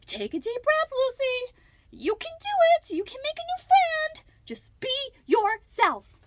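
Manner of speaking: put-on very high, loud encouraging girl voice, slowing at the end; self pep talk. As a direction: talk herself into calm. Lucy calming herself.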